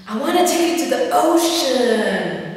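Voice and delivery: high, slow, drawn-out mock pick-up line; hammed-up imitation of a weird admirer; strange suitor imitation. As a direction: croon it like an oddball stranger, loud and lingering. Acts out strange man's pick-up line.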